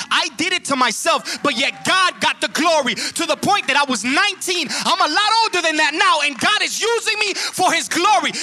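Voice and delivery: high, loud, swelling testimony; preaching at full force. Acted. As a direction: lift the crowd with each line.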